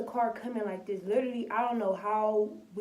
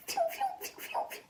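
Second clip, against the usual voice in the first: breathy whizzing phew noises, one shooting high; imitated bullet whizzes. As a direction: mimic bullets flying past. Imitating bullets flying past.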